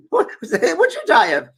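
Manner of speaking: high, loud Scottish question; Scottish accent. Retold or imitated. Scottish lady questions dead little girl, high Scottish accent. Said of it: "I'm trying to my Scottish accent".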